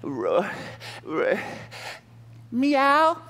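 fading roar ending in a high meow; weak roar to meow. Acted. Trapped lion's roar shrinks to a tiny high meow. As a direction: roar dwindles to a kitten's meow. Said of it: "roar sounded like".

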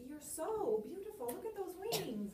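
high, sugary flattery from a scheming spider; butter up the fly, pitched high; flattering spider. Shown as a demonstration. Spider flattering the fly, sweet and high. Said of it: "he is flattering him".

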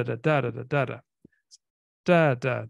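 "Da, da, da" with the first beat strong, two light; dactylic rhythm. Shown as a demonstration. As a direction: tap out dactyls on "da", strong-weak-weak. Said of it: "stressed, unstressed, unstressed".

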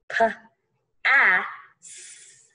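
"p, a, s" as three separate sounds; p, short a, one long hissed s; sounded out. Shown as a demonstration. Teacher shows one sound for double S, sounding out. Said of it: "one sound".

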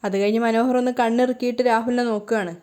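ordinary delivery

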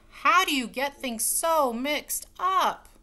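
high, loud, slow exasperation; exasperated exclamation. Acted. Wife scolding the maid, loud and slow. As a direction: plead in frustration. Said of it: "exclaimed Missus Rogers".

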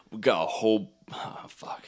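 voiceless, faltering whisper; whispered false start. Real, not acted. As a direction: start the quote under your breath and give up. Falters before offensive movie quote.

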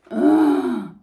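a loud, disgusted groan; a bored boy's loud groan of protest. A narrator's reading. Henry groans at the thought of a walk, loud. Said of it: "Henry groaned".

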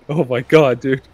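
ordinary delivery

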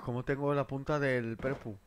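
usual speaking voice

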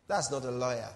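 ordinary talk, nothing marked

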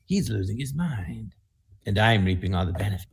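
hammed-up lounge singer crooning slowly; wedding singer act. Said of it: "I could do it for him".

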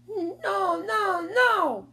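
high, wailing protest; drawn-out, high child's yell; shouting little boy. A narrator's reading. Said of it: "Fudge shouted".

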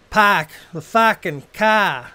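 loud, drawn-out Boston accent with broad "ah" vowels. Shown as a demonstration. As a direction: demo Boston speech, dropping the R's into long "ah". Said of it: "Boston accent is any time you say any vowels".

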